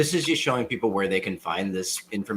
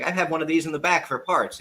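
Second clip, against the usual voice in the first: higher-pitched offhand offer; imitating the boss; 7 semitones higher than usual. Boss offers a spare screen, lightly imitated. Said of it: "And he's like".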